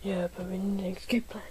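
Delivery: fast, hushed whisper. Acted. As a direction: whisper the plan quickly. Whispered escape plotting.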